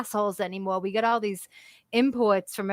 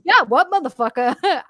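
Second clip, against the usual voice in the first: jovial Boston bluster; 5 semitones higher, 6 dB louder than usual. Retold or imitated. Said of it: "We'd be like".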